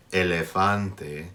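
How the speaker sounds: slow, pure E in elefante throughout; slow exaggerated speech modeling. Shown as a demonstration. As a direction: stretch out elefante, every E the same. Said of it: "has the same E sound throughout".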